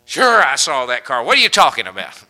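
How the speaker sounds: loud, irritated brush-off; defensive husband. Retold or imitated. Husband answering his worried wife, loud and irritated. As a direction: snap back defensively. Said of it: "My wife does me this way".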